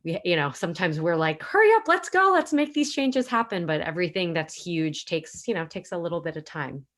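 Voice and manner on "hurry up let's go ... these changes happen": high, loud, impatient cheer; mock impatient urging. We want changes to happen sooner, mock impatient urging. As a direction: call it out high and urgent, easing off slightly.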